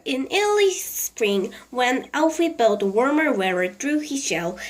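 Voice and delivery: ordinary delivery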